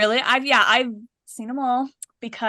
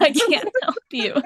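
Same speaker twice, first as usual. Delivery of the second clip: amused echo; 7 semitones higher, 4 dB louder than usual. Retold or imitated. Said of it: "laughs after repeating".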